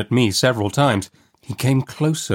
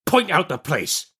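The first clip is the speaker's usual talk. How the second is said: slow, higher-pitched rough demand; menacing convict; 8 semitones higher than usual. A narrator's reading. Man demanding where the boy lives, slow and menacing. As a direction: draw the order out as a threatening convict.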